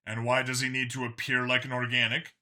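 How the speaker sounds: ordinary delivery, nothing marked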